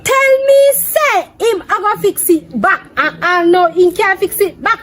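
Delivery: high-pitched, loud ranting; loud complaint. Real, not acted. Complaining about the promised bike repair, loudly. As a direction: rant it out high and indignant. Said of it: "Why you talk so loud?".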